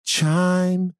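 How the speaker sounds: very slow, drawn-out segment announcer. Acted. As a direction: stretch it out like a show jingle. Repeated segment intro.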